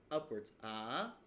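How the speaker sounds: ah sliding upward, Mandarin second tone; ah as a rising Mandarin tone. Shown as a demonstration. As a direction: let ah climb in pitch. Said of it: "it can rise upwards".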